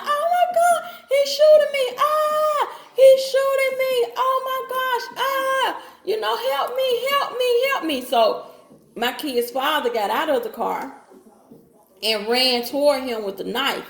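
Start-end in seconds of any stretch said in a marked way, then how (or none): 0.0-8.0 high, loud screaming cries for help; frantic scream of someone being shot at; retold panicked screaming. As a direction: relive the moment of being shot, shrieking. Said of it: "screaming and hollering".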